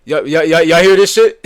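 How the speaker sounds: loud, high, repeated call to the audience; incredulous shout. Real, not acted. Streamer calling on viewers to hear this, shouting incredulously. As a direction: yell it out in outraged disbelief. Calls audience to witness the clip.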